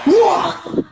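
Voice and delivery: high cry of delight; excited shout. Real, not acted. Fan keeps cheering after the double play. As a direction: let it ring out. Still celebrating.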